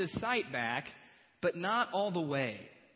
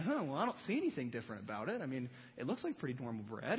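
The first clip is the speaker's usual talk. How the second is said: clueless disciple; 5 semitones lower, 5 dB quieter, faster than usual. Retold or imitated. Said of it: "they're picking up their bread and saying".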